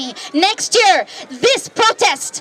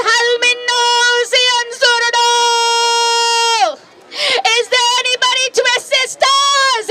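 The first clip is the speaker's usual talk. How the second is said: mournful lamenting call; 8 semitones higher than usual. Acted. Hussein's cry for help recited.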